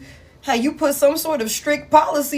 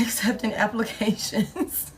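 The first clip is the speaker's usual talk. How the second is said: words breaking into a laugh; real giggling fit mid-sentence; cracking up; 3 semitones lower, 8 dB quieter than usual. Real, not acted. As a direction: laugh helplessly through the end of it. Cracks up at own joke.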